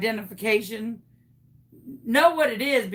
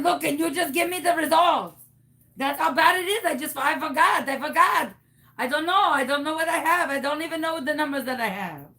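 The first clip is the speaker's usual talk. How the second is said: put-on high, pleading phony French-Canadian accent; fake Canadian accent; 3 semitones higher than usual. Caller begging the clerk for results, fake Canadian accent. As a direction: plead in a hammed-up accent, piling up excuses. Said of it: "What happened to your accent?".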